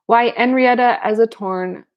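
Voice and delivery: French accent with dropped h, badly faked; attempted French accent. A narrator's reading. Marianne gaily answering Carmen, attempted French accent. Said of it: "I can't do this French accent".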